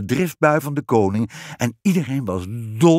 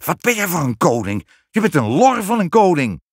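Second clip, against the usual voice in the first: exasperated queen, rising higher and louder; indignant outburst; indignant scolding; 6 semitones higher, 4 dB louder than usual. A narrator's reading. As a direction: scold him, building to the insult. Queen scolds her useless husband.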